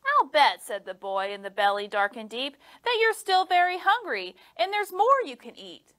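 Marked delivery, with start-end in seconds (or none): 0.1-0.6 put-on high, eager boy voice; bright little boy talking. A narrator's reading. Boy voice in story. 2.8-5.9 put-on high, coaxing boy voice; eager little boy coaxing. A narrator's reading. Boy voice in story.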